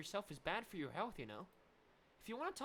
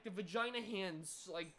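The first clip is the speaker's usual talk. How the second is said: normal talking voice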